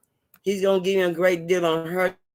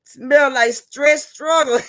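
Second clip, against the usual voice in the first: high, loud, slow mock exclaim; high squeaky imitation of a friend's joke; TT's joking quip; 8 semitones higher, 8 dB louder, much slower than usual. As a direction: quote TT's line with big drawn-out disbelief. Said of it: "T T said".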